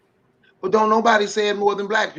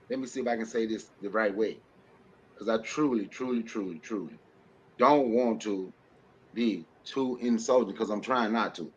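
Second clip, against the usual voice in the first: careful quiet aside; 8 semitones lower, 7 dB quieter than usual. Real, not acted. Careful hedge before a harsh remark.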